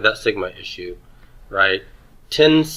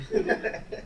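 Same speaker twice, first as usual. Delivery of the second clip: short high airy laugh; a quick amused giggle; 11 dB quieter than usual. Real, not acted. Teacher laughing at a student's pun, high giggle.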